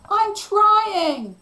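high, loud strained protest; loud, high-pitched shout; yelled back. A narrator's reading. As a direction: yell back under strain. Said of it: "Cliff yelled back".

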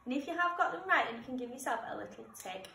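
normal talking voice

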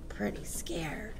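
very soft, slow whisper; whispered reply. Acted. Pupil to pupil in a hushed assembly, whispered. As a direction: whisper it under the breath. Children talk quietly in assembly.

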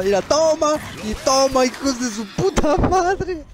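very high, giggling gloat; cracking up over the kill; squeaky laughing victory; giddy laughing triumph. Real, not acted. Giggling triumph after kills.

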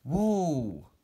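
loud startled whoa at fast tarantula attack. Real, not acted. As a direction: react to a sudden strike. Fast strike at food.

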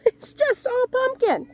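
high, slow, laughing exclamation; laughing disbelief. Real, not acted. Gardener overrun by pumpkins, laughing in high-pitched disbelief. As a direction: repeat it in a high, amused squeal.

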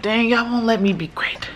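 whisper. Retold or imitated. Mom whispers a tired gripe about her babies. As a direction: whisper it in mock frustration. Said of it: "I'm like".

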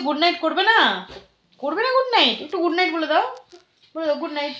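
high sing-song coaxing to a little one. Real, not acted. Mother coaxing baby to say goodnight.

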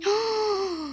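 high, drawn-in gasp of amazement; shocked gasp. Real, not acted. Player seeing the huge tsunami, shocked gasp. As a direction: gasp at something enormous.

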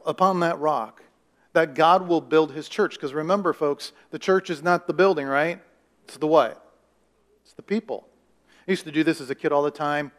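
usual speaking voice

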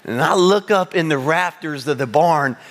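ordinary talk, nothing marked

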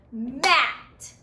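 single clapped beat on Mat; one clap for Mat; clapped syllable. Shown as a demonstration. Teacher to class, clapping one syllable. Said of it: "Mat has one vowel sound".

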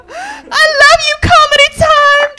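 gushing sign-off. Real, not acted. Comic thanking the Comedy Time audience, gushing. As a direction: squeal your love to the crowd.

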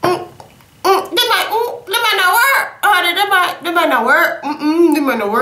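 high doubtful hums, half laughing; playful high-pitched "this might not work"; mock skeptical. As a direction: squeak out the doubt through giggles. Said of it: "I was like".

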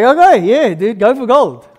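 enthusiastic approval. Retold or imitated. Grandma cheers on grandson becoming a pastor, imitated excitement.

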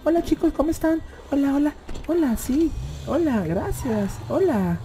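high, soft, mincing greeting; mock a prissy guy saying hello, high and soft; teasing impression of a dainty greeter; mocking effeminate voice. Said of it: "habla como hombre".

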